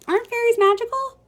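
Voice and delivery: plain reading voice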